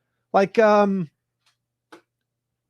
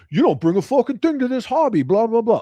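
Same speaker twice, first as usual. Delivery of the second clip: mock gatekeeper sneer; 7 semitones higher, 4 dB louder than usual. Mocking a gatekeeper's attitude.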